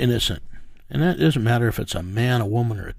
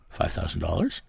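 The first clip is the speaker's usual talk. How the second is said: questioning rise, softer, in disbelief; incredulous echo; 4 semitones lower, 6 dB quieter than usual. Retold or imitated. Partner hearing the offer, mild incredulous question. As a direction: repeat the sum back as if unsure. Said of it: "And he goes".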